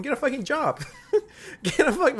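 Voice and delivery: high-pitched scolding breaking into laughter; laughing scolding. Real, not acted. YouTuber telling grown adults to get a job, laughing scolding. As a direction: try to scold, crack up halfway.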